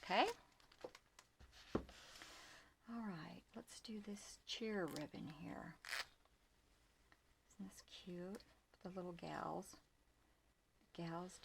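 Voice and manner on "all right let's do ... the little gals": whispered aside to herself; soft whisper. Real, not acted. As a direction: drop to a whisper while sorting. Moving to next ribbon, hushed.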